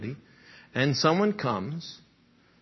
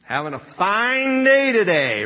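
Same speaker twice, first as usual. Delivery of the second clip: loud, sharp and higher, with an edge; angry tone; 5 semitones higher, 6 dB louder than usual. Shown as a demonstration. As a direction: bite off the words like an insult. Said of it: "What did that sound like?".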